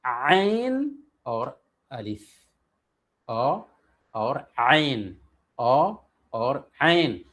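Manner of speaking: आइन from the throat, अलिफ with a plain open अ, said slowly; ain against alif. Shown as a demonstration. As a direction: draw out अ and आइन to show the difference. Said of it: "तो फ़र्क कितना है". (आइन और अलिफ का फ़र्क धीरे-धीरे)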